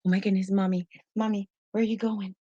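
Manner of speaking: pleading little-dog voice; voicing the dog. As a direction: play the clingy dog calling for mommy. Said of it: "she's always so".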